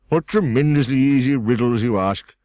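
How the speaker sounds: loud, scornful egg voice; growled out. A narrator's reading. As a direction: scoff at her easy riddle. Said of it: "Humpty Dumpty growled out".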